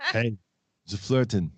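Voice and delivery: put-on Arnold-style Austrian accent. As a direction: do an Arnold accent, picking a quarrel. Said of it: "like to hear Arnold and the Flirtenflaus".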